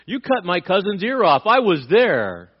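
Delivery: loud, high-pitched outburst, stretched at the end; hammed-up indignant shout; indignant accusation. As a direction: yell it as an outraged eyewitness. Acting indignant cousin confronting Peter.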